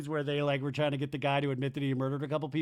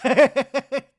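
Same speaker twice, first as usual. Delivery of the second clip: cracks up loudly at the line; a big helpless laugh; loud burst of laughter; 10 semitones higher, 15 dB louder than usual. Real, not acted. Laughing out loud at Zach's reply.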